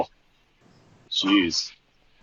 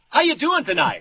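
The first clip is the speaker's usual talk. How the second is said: mock frontman; 12 semitones higher than usual. Mock rock frontman greeting crowd.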